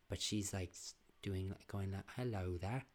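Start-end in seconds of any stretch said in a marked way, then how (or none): 2.2-2.8 soft British "Hello there"; British accent. Shown as a demonstration. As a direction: say it as a proper British grandmother. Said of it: "British, like that".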